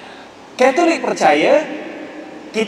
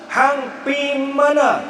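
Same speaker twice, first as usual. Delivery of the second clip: very slow, higher Kedah Malay, mana falling; thick Kedah dialect; 6 semitones higher, much slower than usual. Shown as a demonstration. Lecturer exaggerating Kedah speech for the audience, slow broad Kedah. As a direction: draw out a broad Kedah question. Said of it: "Lari jauh".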